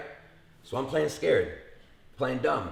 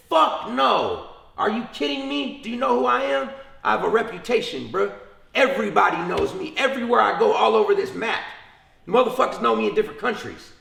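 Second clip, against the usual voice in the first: higher and louder, offended protest; retold outraged denial; indignant outburst; 10 semitones higher, 5 dB louder than usual. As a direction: bristle at the insult, voice up high. Offended at being called snitch.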